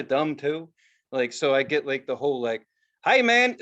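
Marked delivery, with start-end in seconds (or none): 3.1-3.6 put-on Midwest accent. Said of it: "like, that accent".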